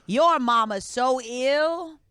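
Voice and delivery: drawn-out comedian setup; slow joke setup. Acted. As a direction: stretch the setup slowly. Ghost comic delivers joke setup.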